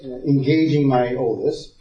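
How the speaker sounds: ordinary delivery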